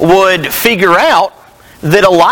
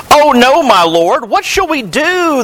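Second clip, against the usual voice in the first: high-pitched, mildly panicked cry; mock alarm; 8 semitones higher than usual. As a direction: read it as a servant's alarmed plea. Servant sees the surrounding army.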